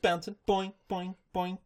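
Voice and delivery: high, springy boings; bouncy sound-effect. Retold or imitated. Recapper mimics a pony hopping along, bouncy. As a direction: bounce each word out lightly. Miming Pinkie Pie's bouncing.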